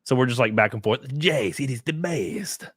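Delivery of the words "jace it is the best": slow, lightly put-on Mexican accent; playfully imitating a Mexican coworker's accent; a hint of a hammed-up Mexican accent.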